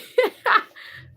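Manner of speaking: high, airy laugh; amused laugh. Real, not acted. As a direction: break into a high chuckle. Amused at a viewer named Nikki.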